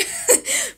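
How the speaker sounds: high, self-mocking giggle; laugh. Real, not acted. Joking to the few subscribers, a high self-mocking giggle. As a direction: laugh at your own joke. Joke about losing a subscriber.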